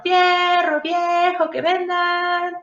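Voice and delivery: high, drawn-out vendor call; street vendor cry. Retold or imitated. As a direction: call it out like a scrap buyer. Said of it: "solamente gritaban".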